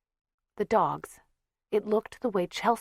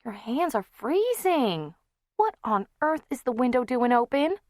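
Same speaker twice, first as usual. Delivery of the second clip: fussing aunt; 7 semitones higher, 3 dB louder than usual. Acted. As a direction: fuss over the child.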